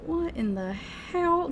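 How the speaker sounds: high, drawn-out disbelief; incredulous. Real, not acted. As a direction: stretch it out high, utterly baffled. Incredulous reaction to caption.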